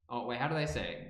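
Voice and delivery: ordinary delivery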